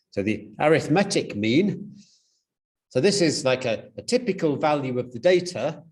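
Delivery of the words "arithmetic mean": arithmetic stressed on the E, a-rith-MET-ic; adjective stress. Shown as a demonstration. Lecturer restates the corrected term, arithmetic as a-rith-MET-ic. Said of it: "you put the emphasis on the E".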